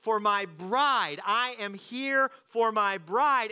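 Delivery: a lightly voiced Jesus, earnest and grand; Jesus declaring. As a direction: proclaim it slowly as the groom coming for his bride. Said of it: "Jesus is saying".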